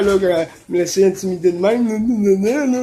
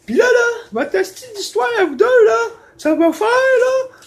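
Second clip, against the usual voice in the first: much higher and louder, screechy landlady; shrill angry landlady; 3 dB louder than usual. Retold or imitated. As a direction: scream it like the furious landlady. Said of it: "elle dit". (propriétaire hystérique imitée, très aiguë et forte)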